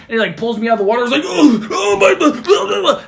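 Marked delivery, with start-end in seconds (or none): 1.2-3.0 high, loud panicky babble; squeaky mock panic; panicky kid.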